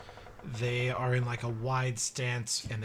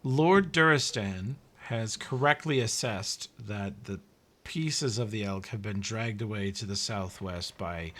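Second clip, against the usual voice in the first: usual speaking voice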